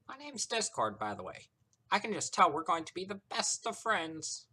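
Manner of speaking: high, showy, teasing introduction; gleeful trickster. A narrator's reading. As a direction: gush with mock friendliness. Voices Discord introducing himself.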